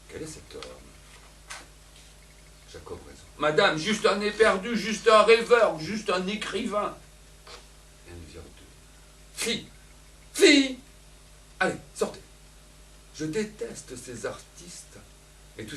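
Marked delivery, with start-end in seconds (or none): 3.4-7.0 lightly voiced suitor. Don Juan answers Elvire. 9.4-12.2 sharp raised call, fille pitched high and loud. Acted. Elvire chasing the girl out, with a sharp raised call.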